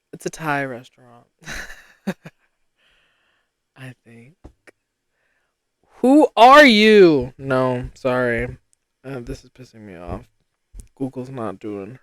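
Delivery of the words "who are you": indignant shout. Real, not acted. Exclaiming at phone result.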